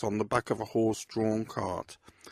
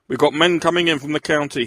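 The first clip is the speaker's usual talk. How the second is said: loud commanding bark; a police chief barking orders at his men; 5 semitones higher, 8 dB louder than usual. Acted. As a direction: bark it like a superintendent in charge. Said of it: "he barked".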